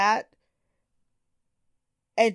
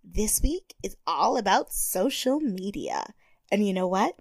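put-on soft whispery little-girl voice; breathy baby-talk host read; Tyra baby voice; 3 semitones lower, 5 dB quieter than usual. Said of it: "Tyra Baby voice is on point".

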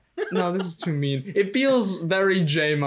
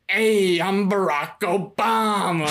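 slow, stretched-out mock impression; bad Barack Obama; 3 semitones higher, slower than usual. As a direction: play a hopelessly bad impressionist, drawing out the name. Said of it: "like, a really bad impression".

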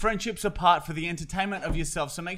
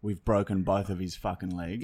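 police officer voice; 6 semitones lower, 4 dB quieter than usual. Acted. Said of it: "Constable Ben, Anderson here".

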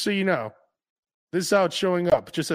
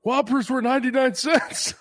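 put-on higher, nostalgic old-timer; 7 semitones higher than usual. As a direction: reminisce like a grandpa. Mock old-timer reminiscing.

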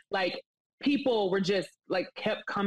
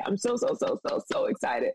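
giddy, rising on each so; excited. Real, not acted. Guest gushing about her pitch, rising excitement. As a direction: voice climbing high on repeated so. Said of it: "I'm so excited".

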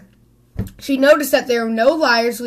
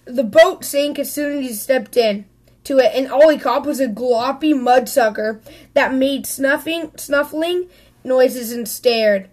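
ordinary delivery, nothing marked

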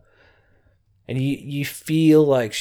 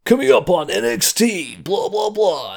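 high-pitched mock American announcer; put-on American promo voice, pitched right up; 9 semitones higher than usual. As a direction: imitate an excitable American TV announcer. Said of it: "like, American voices".

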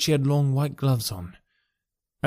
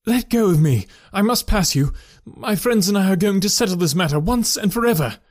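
louder, higher, commanding; forceful husband; 7 semitones higher, 4 dB louder than usual. A narrator's reading. Husband forcing his way past his wife, louder and commanding. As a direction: push past her, unyielding.

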